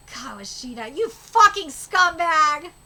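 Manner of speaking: slow, loud snarling insult; slow furious shout; furious yell. Acted. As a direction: spit it at the villain. Hero confronts the villain.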